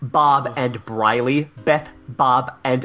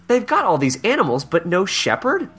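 mock indignant columnist; 6 semitones higher than usual. Voicing the columnist in a reading.

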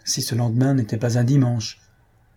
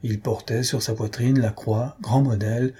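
usual speaking voice

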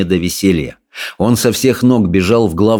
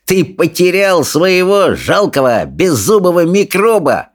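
sneering, sing-song bully, pitched way up; jeering bully; 9 semitones higher than usual. A narrator's reading. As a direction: taunt the others with relish. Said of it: "не унимался Сопляк".

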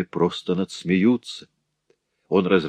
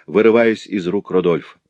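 ordinary delivery

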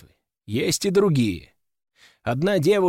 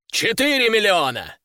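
raised, high-pitched call; shouted bid; 6 semitones higher than usual. A narrator's reading. A count outbids the hero at auction, calling out high. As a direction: outbid them across the room. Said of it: "крикнул вроде бы какой-то граф".

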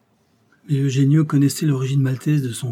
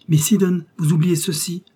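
higher, raised polite call; calling her back; 6 semitones higher, 4 dB louder than usual. A narrator's reading. Detective calls woman back about her scarf, calling out. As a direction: call to catch her before she goes.

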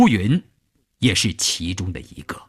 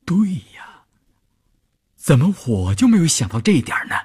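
sudden realisation; 6 semitones higher than usual. A narrator's reading. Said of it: "高过一想".

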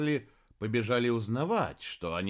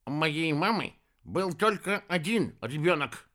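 a touch sharp, raised pitch; sharp retort; 6 semitones higher than usual. A narrator's reading. Хиша corrects the sorceress about a brother, sharp retort. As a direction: set them straight in a higher voice. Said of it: "резко сообщил Хиша".

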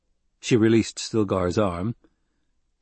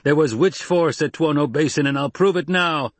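raised, higher threatening voice; loud threat; 7 semitones higher, 4 dB louder than usual. Acted. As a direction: swear to prove it, loud. Jameis keeps roaring.

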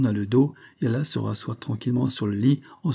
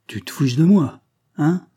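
louder, higher indignant challenge; cried out; 5 semitones higher, 6 dB louder than usual. A narrator's reading. Convict protesting at being mocked by detective, crying out. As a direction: raise your voice in protest. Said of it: "cria Langlade".

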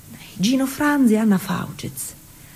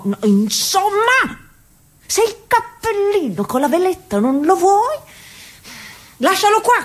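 much higher, fed-up pleading; exasperated; 6 semitones higher than usual. Acted. Daughter losing patience with her mother over the hat, exasperated and higher. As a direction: throw up your hands at her.

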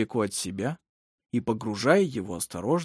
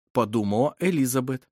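usual speaking voice

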